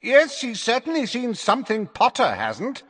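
high, carrying commentator voice; Zacharias Smith on megaphone. A narrator's reading. Commentator needling Potter before the crowd, high megaphone call. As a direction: announce it high, as through a megaphone. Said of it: "said Zacharias Smith through his megaphone".

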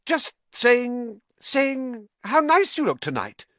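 high, stumbling fake compliment; squeaky forced flattery; flustered cover-up. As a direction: scramble to sound nice.

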